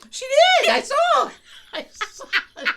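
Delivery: high, loud protest; wife insisting through laughter; insistent yell. Retold or imitated. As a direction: yell that she saw it. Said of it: "I'm like".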